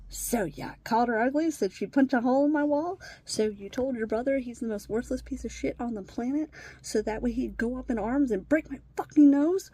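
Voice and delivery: loud, accusing rant at the stepson; angry confrontation. Retold or imitated. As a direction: fire the questions at him, furious. Confronting stepson in retold argument.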